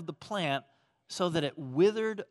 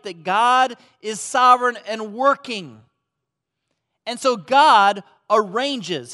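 loud, high preaching voice; full-force preaching; 5 semitones higher, 10 dB louder than usual. Acted. Pastor driving home God's sovereignty, loud and high. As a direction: preach it out with fire.

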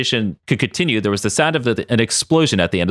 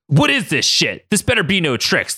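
high-pitched, mildly threatening growl; 8 semitones higher than usual. Acted. Logan pops his claws at the stranger, growling. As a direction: warn them with a half-hearted growl. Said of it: "Logan said with a growl".

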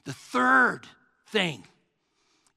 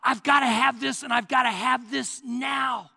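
urgent, raised-pitch demand to God; insistent, fervent prayer; intense plea; 4 semitones higher, 3 dB louder than usual. Acted. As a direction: plead as if it cannot wait. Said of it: "he listens to your intensity".

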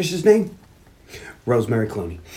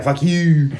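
acted retort at ordinary pitch and volume; mock comeback. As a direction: answer the insult in a put-on voice, without raising it. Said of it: "And he's like".

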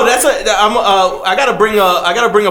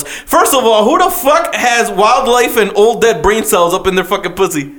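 normal talking voice

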